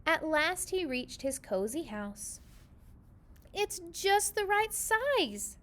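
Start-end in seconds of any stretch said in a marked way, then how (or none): 3.5-5.6 mouse voice. A narrator's reading. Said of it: "said Missus Mouse".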